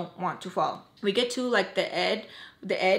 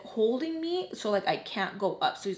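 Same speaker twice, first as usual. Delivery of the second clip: ordinary delivery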